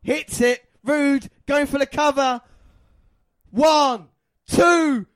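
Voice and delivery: building from excited call to full high shout; drawn-out, roaring title-win announcement; emotional commentary shout. Real, not acted. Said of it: "so much emotion in my voice".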